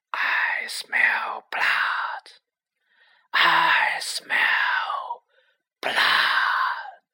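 unvoiced, menacing whisper; cold murderous whisper. A narrator's reading. Unseen monster whispers its hunger to kill. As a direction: hiss each word like a hidden killer.

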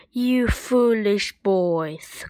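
slow, scolding mother's voice. Acted. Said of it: "cried Jack's mother".

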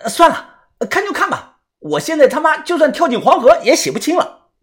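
high, fed-up resignation; aggrieved high-pitched complaint; exasperated giving in. A narrator's reading. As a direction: give in grudgingly in a high voice. Gives in, exasperated.

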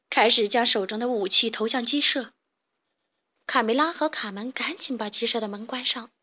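ordinary delivery

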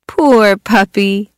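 slow, drawn-out poor puppy; sorry for the puppy; slow pity. Acted. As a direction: feel sorry for the dog, slowly. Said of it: "said the kids".

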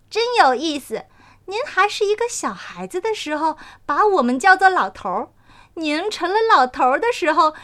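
a flower spirit granting a wish; amused dandelion. Acted.

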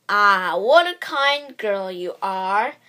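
slow, loud wolf voice with a grin; fake-friendly growl, drawn out; smiling wolf. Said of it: "smiled the wolf".

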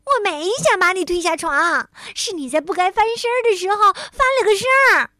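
high, loud, hurried self-defence; indignant. A narrator's reading. Tiaotiao denies pushing friend off bed, indignant. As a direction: argue back hotly.

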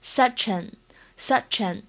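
"such an" run together, slowly; "such an" said slowly as one joined word; linked pronunciation. Shown as a demonstration. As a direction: link the ch of "such" straight into "an". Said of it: "可以稍微连读".

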